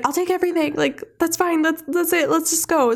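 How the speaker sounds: high-pitched mock panic; freaking out. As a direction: imitate a flustered kid desperate to leave. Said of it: "he kind of freaks out".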